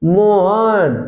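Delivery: calling out Mohan to a friend; calling Mohan. Shown as a demonstration. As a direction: call Mohan as if to someone nearby. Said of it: "जैसे किसी को बुलाना हो".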